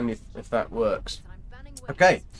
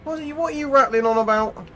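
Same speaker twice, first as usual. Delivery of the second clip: incredulous high voice; 13 semitones higher, 4 dB louder than usual. Real, not acted. Streamer mocking chatter, high.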